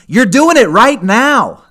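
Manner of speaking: loud, high emphatic declaration. Real, not acted. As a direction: drive the point home hard. Emphatic point to listeners.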